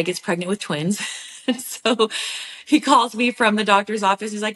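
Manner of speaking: ordinary talk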